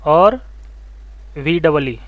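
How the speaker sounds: "VCC", "और", "वीडबलई" each set apart by a long pause; dictation. Shown as a demonstration. Teacher has students write it below the diagram, halting dictation of "VCC और वीडबलई". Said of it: "नीचे में लिख लो भैया".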